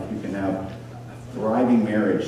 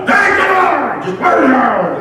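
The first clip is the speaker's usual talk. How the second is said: much higher, loud shouted order; screaming sergeant; 8 dB louder, slower than usual. Retold or imitated. New sergeant screaming at the new guys, loud shout. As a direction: bark it like a raging sergeant. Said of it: "just screaming at him".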